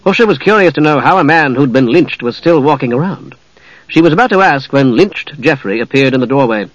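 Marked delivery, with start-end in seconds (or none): none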